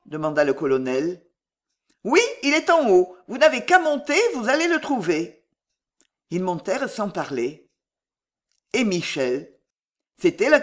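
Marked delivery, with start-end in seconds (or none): 2.0-5.4 higher, louder voiced reply; high-voiced woman answering a visitor; concierge's wife. A narrator's reading. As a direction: give helpful directions in a raised pitch.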